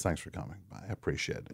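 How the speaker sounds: quiet, heartfelt thanks; sincere. Real, not acted. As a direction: drop to a sincere hush. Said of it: "in all seriousness".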